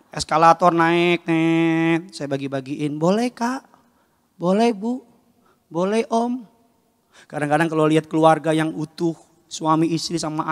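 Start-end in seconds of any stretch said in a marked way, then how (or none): 3.0-6.5 salesboy hawking. Retold or imitated. Retold flyer hawking in mall.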